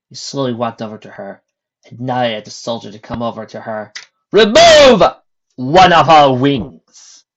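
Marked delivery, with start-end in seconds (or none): 4.3-7.2 loud, high, slow proclamation; stern captain. A narrator's reading. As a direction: lay down the law to the crowd. Captain orders.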